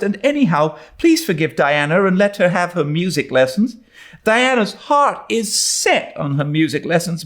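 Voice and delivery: put-on high earnest young girl steeling herself; nervous but determined; Anne, brave girl. A narrator's reading. Anne to Diana, earnest and high.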